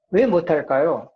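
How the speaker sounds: ordinary delivery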